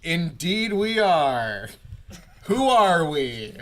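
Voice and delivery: slow, loud, drawn-out proclamation; grand in-character reply. Acted. Player answers the startled guard, mock-grand. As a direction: declaim it with mock grandeur, very slowly.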